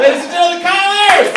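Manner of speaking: high, loud mock announcer shout. Introducing Kyle to the crowd as a hyped announcer. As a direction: bellow it like a showman introducing the star.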